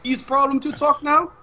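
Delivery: a doting father checking on his son; Papa on the phone. Acted. Papa calls his son mid-mission, high accented voice.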